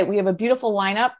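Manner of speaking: ordinary talk, nothing marked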